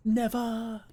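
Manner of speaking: high shouted refusal; mock shout. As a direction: yell it high and loud. Mock Star Wars reply.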